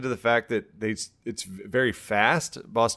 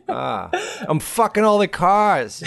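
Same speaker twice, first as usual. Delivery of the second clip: high mock South Boston accent through laughter; silly, excited Boston-accented lover; 10 semitones higher, 4 dB louder than usual. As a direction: ham up a Boston boast. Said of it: "doing the South Boston accent".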